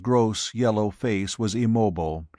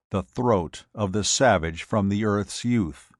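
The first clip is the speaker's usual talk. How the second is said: ordinary delivery, nothing marked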